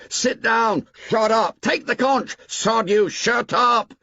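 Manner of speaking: high, loud uproar of yells; a storm of boys shouting down the speaker. A narrator's reading. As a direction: yell over each other. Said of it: "the storm broke".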